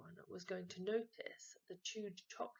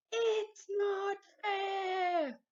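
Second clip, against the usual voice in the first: very high, loud, stretched-out screech; 12 semitones higher, 10 dB louder, much slower than usual. A narrator's reading. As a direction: wail it like a tantrum. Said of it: "screeched Henry".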